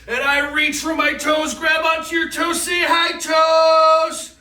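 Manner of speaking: upbeat cheering coach; excited. Real, not acted. Energetic happy coaching to kids.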